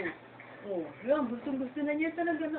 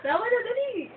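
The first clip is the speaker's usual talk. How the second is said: laughing high; 10 semitones higher, 4 dB louder than usual. Real, not acted. Laughing at baby.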